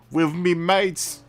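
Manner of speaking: high, loud, drawn-out mock London accent. Shown as a demonstration. Streamer demonstrating the F V sound, exaggerated London accent. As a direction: stretch it out in playful Cockney style, th as v. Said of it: "It's like an F V".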